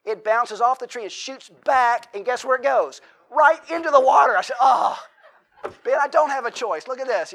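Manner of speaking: hammed-up outcry as the ball hits the water; loud exasperated punchline; comic dismay. Ball lands in water, exclaims.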